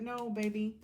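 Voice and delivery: usual speaking voice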